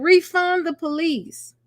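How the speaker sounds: imitating her high, slow "Refund the police."; high mock chant of "Refund the police."; mocking protester. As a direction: mimic the protester's chant, drawn out. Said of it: "she said".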